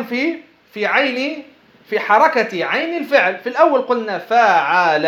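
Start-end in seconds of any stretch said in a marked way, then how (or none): 4.2-5.0 "فعل" stretched out as faa-aa-laa; middle vowel fatha. Shown as a demonstration. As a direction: sound out "فعل" slowly with open "a" vowels. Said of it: "في الماضي العين مفتوحة".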